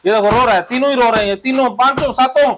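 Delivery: high-pitched, relentless egging-on; fired-up therapy drill leader; heated cathartic incitement. Acted. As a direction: goad them to attack the imagined enemy. Crows over imagined crying enemies.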